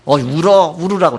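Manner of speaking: loud, insistent mock urging; urging villagers. Villagers telling bereaved children to cry at the funeral, imitated loud urging. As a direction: imitate villagers pressing someone loudly. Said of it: "동네 사람들이 와서 막 그래요".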